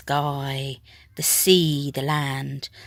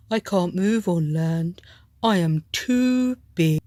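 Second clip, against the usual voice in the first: moan; 4 dB louder than usual. A narrator's reading. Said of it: "moaned the whale".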